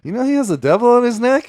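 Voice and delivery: uncle's question. Retold or imitated. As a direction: ask it wide-eyed, scandalized. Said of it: "my uncle from Wisconsin, like".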